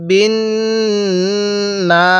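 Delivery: বিন with a long nasal hum on the n, then না; ghunnah demo. Shown as a demonstration. Teacher shows ghunnah on the nun, বিন hummed long before না. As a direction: hold the n of বিন through the nose before না. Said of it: "এই নুনের মধ্যে আমি গুন্নাহ করছি".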